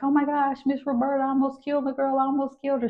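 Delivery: high, distressed wail; high upset confession to the instructor; traumatized. Retold or imitated. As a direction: sure she almost killed the patient. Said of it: "it was very traumatic because I was like".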